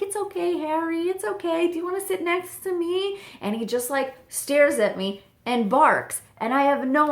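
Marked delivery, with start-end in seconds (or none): 0.0-3.2 high, gentle reassurance to a pet; soothing the dog. Retold or imitated.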